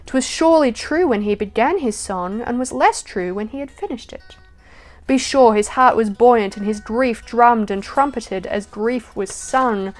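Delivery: emphatic, measured poetry reading; poem recital. Acted. Recites James Stephens poem.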